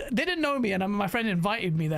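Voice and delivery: ordinary talk, nothing marked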